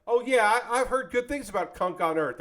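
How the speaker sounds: breezy acted name-drop; casual enthusiasm. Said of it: "I'm gonna be like".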